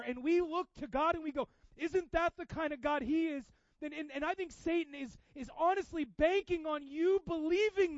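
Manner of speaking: loud, soaring preacher's voice; preaching at full force. Acted.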